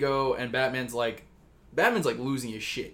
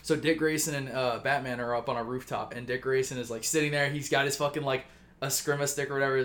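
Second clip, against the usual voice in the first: plain reading voice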